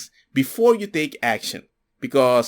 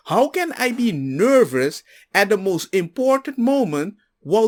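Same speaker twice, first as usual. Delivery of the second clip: high, scolding rant at the father-in-law; retelling how he scolded his father-in-law in the hospital; harsh retort; 8 semitones higher than usual. Retold or imitated. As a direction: tell him off, incredulous and sharp. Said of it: "they thought I was very harsh".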